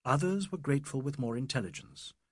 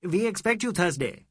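"expect" hit, louder and higher; loud insistence; 6 semitones higher, 6 dB louder than usual. A narrator's reading. Mr Bhattacharya inviting the ladies, loud on "expect". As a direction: press the word "expect".

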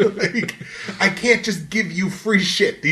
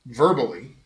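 usual speaking voice

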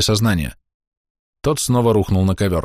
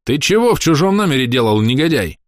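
angry; 5 semitones higher than usual. Acted. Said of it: "сердитый оклик Масао".

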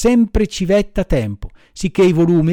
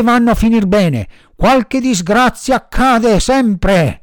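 slow, loud, high crab bellow; ugly trombone-with-a-cold voice; 6 semitones higher, 5 dB louder, slower than usual. A narrator's reading. Big crab scolding fighting boys, ugly congested bellow. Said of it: "gridò con una vociaccia di trombone infreddato".

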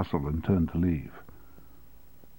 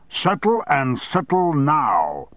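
slow, a little raised demand; shouted; 6 semitones higher, slower than usual. A narrator's reading. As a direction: press each word as a mild shout. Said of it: "shouted Armstrong".

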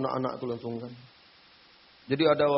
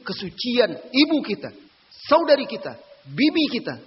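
higher, louder peak of the sermon; preaching climax; 10 semitones higher, 9 dB louder than usual. Acted. Preacher defending mothers and sisters, rising to a loud high peak. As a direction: push the list to a ringing climax.

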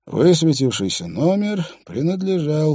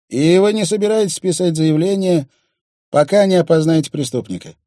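raised, impatient cop voice; Truls, gruff cop; 7 semitones higher, 7 dB louder than usual. Acted. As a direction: bargain impatiently, pushy.

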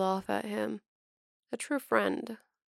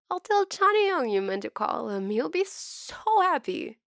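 high whiny voice; 8 semitones higher, 4 dB louder than usual. Acted. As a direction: tease him in a whiny high voice. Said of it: "teases in a high, whiny voice".

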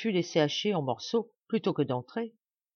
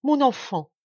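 loud, high cry torn out of her; anguished cry; 8 semitones higher, 8 dB louder than usual. A narrator's reading. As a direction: cry out in sudden terror for her child. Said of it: "lui arracha un cri".

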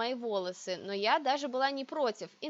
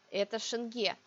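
usual speaking voice

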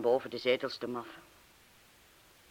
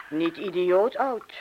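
slow, louder teasing; 4 semitones higher, 8 dB louder, slower than usual. Acted. Young woman teases the man about his age. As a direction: drag it out to needle him.

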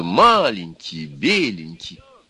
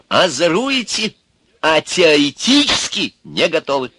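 put-on high, slow old man's scolding; harmless old-man threat; 9 semitones higher, 5 dB louder than usual. A narrator's reading. Sage wagging a finger at the devil, mildly scolding. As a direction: chide them without real menace. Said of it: "стариковскую нестрашную угрозу".

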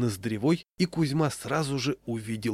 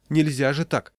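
mildly exasperated, higher and louder; 5 semitones higher, 5 dB louder than usual. A narrator's reading. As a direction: protest at their behavior. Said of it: "не выдержала жена". (с лёгким возмущением)

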